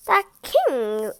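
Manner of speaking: usual speaking voice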